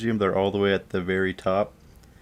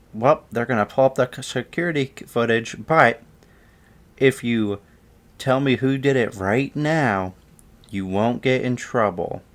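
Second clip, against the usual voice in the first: bargaining teacher; 4 semitones higher than usual. Retold or imitated. Returning teacher bargains, acted.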